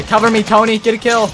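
cover me shout. Real, not acted. Teammate calling out in firefight.

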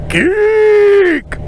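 long, high, loud mock shout; retold heckler's yell. Friend heckling the graduate from the silent crowd, drawn-out yell. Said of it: "in the crowd somewhere yelled out".

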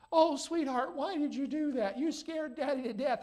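high, cooing put-on sweetness; mock sweet daddy. Preacher imagining a gentle scolding of his daughter, mock sweet. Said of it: "I'm not going to say".

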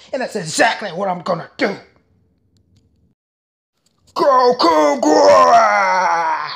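rapid-fire anime character voices; switching impression voices. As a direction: jump from villain to villain. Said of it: "if I do well, let me know".

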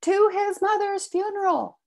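high, loud call after him; desperate shout. Acted. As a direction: shout it at his back as he walks away. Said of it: "and I shouted".

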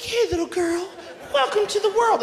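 high, tender coo to a newborn; new mother cooing. Retold or imitated. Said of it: "She's like".

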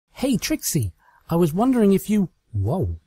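high, fast friendly call cut short; Twilight, cheerful then stunned. Acted. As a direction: breeze in then stop dead. Twilight entering.

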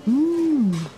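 high, swooping hum of relish; appreciative mmm. Acted. Customer browsing the missed flavours, appreciative mmm. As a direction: hum it long, tempted.

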